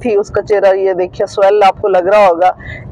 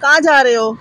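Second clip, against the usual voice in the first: put-on 1 playful baby talk; 6 semitones higher than usual.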